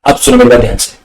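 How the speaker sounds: ordinary talk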